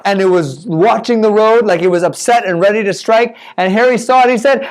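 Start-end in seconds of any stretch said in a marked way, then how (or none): none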